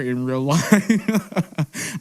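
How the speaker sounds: slow, laughing through words. Real, not acted. Laughs while saying crier.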